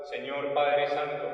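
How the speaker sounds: normal talking voice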